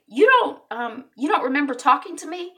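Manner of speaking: high-pitched protesting question; indignant disbelief. Retold or imitated. Wife challenging the doctor, high and incredulous. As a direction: imitate a wife refusing to believe it.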